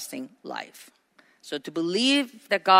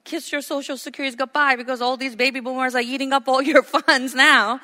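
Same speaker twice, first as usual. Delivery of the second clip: higher, quick mock warning that ends on a laugh; lightly mocking warning, higher and fast, breaking into a laugh; mock doomsayer; 6 semitones higher, 4 dB louder than usual. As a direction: lightly parody the people who gripe about boomers. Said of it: "they always go like".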